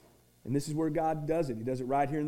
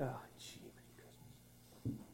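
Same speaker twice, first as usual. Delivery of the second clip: barely voiced whisper, much softer; mumbled brush-off; 3 semitones lower, 22 dB quieter, slower than usual. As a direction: mutter it under your breath, reluctant. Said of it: "And I'm like".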